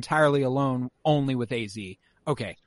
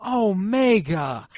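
drawn-out dismay; 8 semitones higher, much slower than usual. Retold or imitated. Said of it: "like, out loud".

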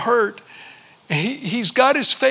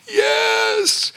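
put-on high child's shouted yes; small boy's yes; 13 semitones higher than usual. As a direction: answer in a little boy's voice. Boy answering father.

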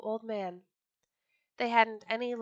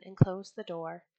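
ordinary delivery

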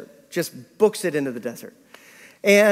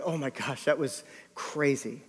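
hushed, retold choked-up reaction; tearing up; 3 semitones lower, 10 dB quieter than usual. Father to son back in the car, mildly tearing up. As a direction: lightly imitate someone holding back tears, soft and low. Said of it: "tearing up, going".

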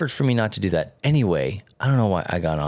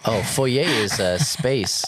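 ordinary talk

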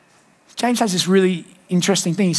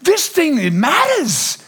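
much higher, slow and insistent; excited high quote; 6 semitones higher than usual. Retold or imitated. Pastor paraphrasing Gandhi, high and slowly insistent. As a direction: push it up high, slow and urgent. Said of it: "And he goes".

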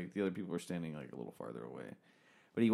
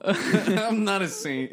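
slow, louder, laughing through it; laughing denial; 7 semitones higher, 6 dB louder, much slower than usual. Real, not acted. Laughing as his friend calls him a saint. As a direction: laugh off the praise.

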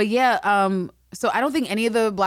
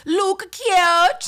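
very high, loud fake Jamaican; hammed-up Jamaican dialect; bad Jamaican accent; 12 semitones higher, 5 dB louder than usual. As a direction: cry it out in awful mock Jamaican. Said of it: "supposed to be talking in a Jamaican dialect".

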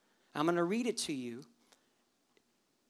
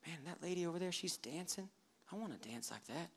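quiet envious thought; 12 dB quieter than usual. Pretend. Worshipper watches a dancing lady, softly murmuring envy. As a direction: murmur it under your breath.

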